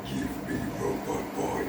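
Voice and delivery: deep, slow belching robot; belched robot voice. Retold or imitated. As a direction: belch the words out like a monster robot. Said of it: "sort of belched his voice".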